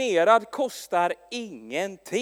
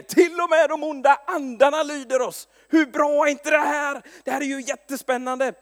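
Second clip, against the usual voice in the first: higher, louder thrilled reporting; excited disciples; 6 semitones higher, 5 dB louder than usual. Retold or imitated.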